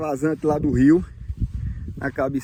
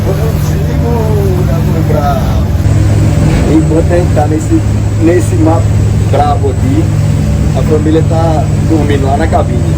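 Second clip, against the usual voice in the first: shouting over wind; 7 dB louder than usual. Real, not acted.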